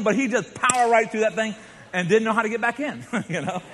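ordinary talk

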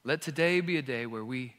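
soft, quiet, earnest prayer; closing prayer. Acted. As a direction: pray gently over the church.

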